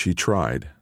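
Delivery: ordinary delivery, nothing marked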